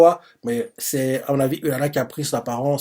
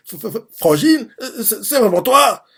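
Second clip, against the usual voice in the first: put-on much higher stuttering boyish voice; stunned stammer; 9 semitones higher, 3 dB louder than usual. Ten on Urara's back asking his sister, much higher stunned stammer. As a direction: stammer in disbelief like a shaken kid.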